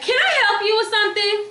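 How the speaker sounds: high, laughing mock confrontation; sarcastic offer of help, laughing; retold snap with laughter. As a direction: laugh while snapping back. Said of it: "And I said it like that".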